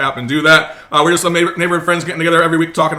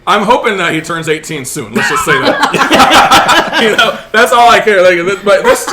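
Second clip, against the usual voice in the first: laughing; 4 semitones higher, 6 dB louder than usual. Real, not acted. Laughing while joking.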